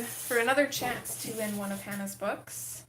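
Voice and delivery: usual speaking voice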